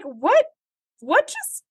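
high, incredulous stammer; baffled. Retold or imitated. Viewer baffled by the holodeck twist, high and incredulous. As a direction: squeak it out in disbelief. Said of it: "And you're just like".

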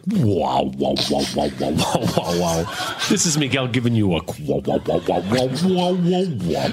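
deep, far-off echoing wows; mock soundscape. Panelist spoofs a voicemail soundscape in a deep echoing voice. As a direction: croon the wows low like an ambient track. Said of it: "Do, like, a soundscape".

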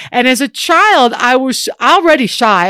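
normal talking voice

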